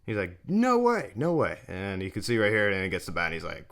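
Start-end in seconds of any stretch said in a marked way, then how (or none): 0.5-1.6 high-pitched mock disbelief; incredulous protest. Acts out batter disputing the call.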